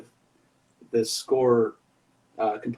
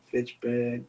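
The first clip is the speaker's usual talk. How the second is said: Fitchburg without the r, softer, Massachusetts style; Massachusetts accent; 5 dB quieter than usual. Shown as a demonstration. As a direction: list Fitchburg like a Massachusetts local. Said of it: "say everything like I'm angry".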